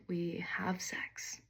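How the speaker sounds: slow, hushed whisper as if someone might hear; whispered confession. Retold or imitated. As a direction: drop to a secret whisper, drawing it out. Scandalous confession, manager next door.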